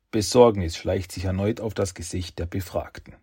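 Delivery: ordinary delivery, nothing marked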